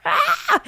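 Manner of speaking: high mock infant shriek; short high baby cry, mimicked; imitated baby scream. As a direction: squeal like a startled baby.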